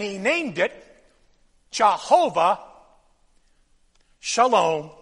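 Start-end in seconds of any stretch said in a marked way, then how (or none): none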